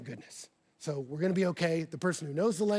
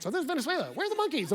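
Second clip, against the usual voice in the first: high, curious voice for a tiny Jesus; lightly voiced Jesus peeking out; pocket Jesus; 9 semitones higher, faster than usual. Pastor imagining Jesus popping from his pocket, curious. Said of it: "He pops out like".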